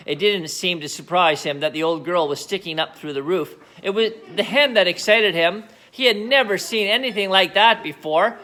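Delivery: usual speaking voice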